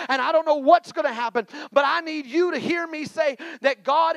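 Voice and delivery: loud, rising sermon climax; preaching at full force. Acted. Preacher assuring the church God has a move, loud preaching. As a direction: drive it home to the congregation.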